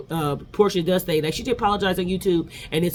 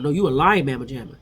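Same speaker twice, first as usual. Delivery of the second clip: normal talking voice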